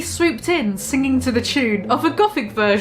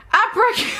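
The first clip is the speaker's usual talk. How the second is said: laughing shout; 11 semitones higher than usual. Acted. Spell yelled, breaks into laugh.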